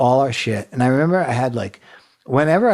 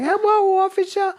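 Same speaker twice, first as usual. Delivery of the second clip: very high put-on slurred voice. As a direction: greet police while faking a stroke. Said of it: "made it look like it was, had a stroke".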